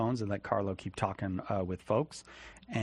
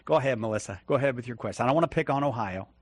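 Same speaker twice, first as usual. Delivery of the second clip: ordinary delivery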